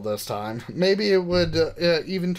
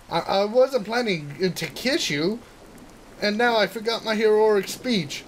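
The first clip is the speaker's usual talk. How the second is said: put-on high, indignant girl protesting; flustered girl voice; 7 semitones higher than usual. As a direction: squeak out the protest, rattled. Narumi and hero banter.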